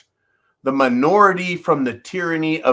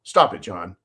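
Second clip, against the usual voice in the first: lower, firm stop; mock stern; 9 semitones lower than usual. Caller telling John to stop, dropped low and firm. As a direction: drop down and scold yourself by name. Caller scolds his other personality.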